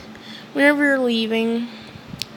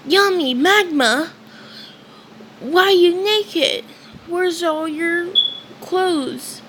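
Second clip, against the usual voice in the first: put-on high excited kid voice, raised pitch; Asta voice; 7 semitones higher than usual. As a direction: bounce up into an eager boyish voice. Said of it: "and he says".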